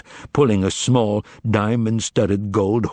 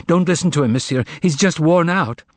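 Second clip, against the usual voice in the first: pleading; 7 semitones higher than usual. Acted. Margarita pleads with Voland.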